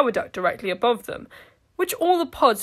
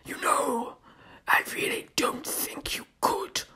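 whispered, slow alien hiss over intercom; a sour, wet whisper of a monster; sour wet creature voice; 4 semitones higher, 7 dB quieter than usual. Acted. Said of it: "the slithering tongue said".

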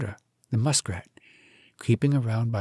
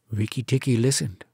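ordinary delivery, nothing marked

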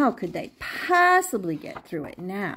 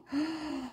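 gasp; 5 semitones higher, 12 dB quieter than usual. A narrator's reading. Storyteller gasps at the surprise.

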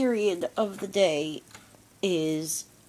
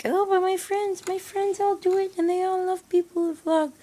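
much higher, louder pleading whine; whiny teen; 13 semitones higher, 5 dB louder than usual. Retold or imitated. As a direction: whine like a begging kid. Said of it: "And then you're like".

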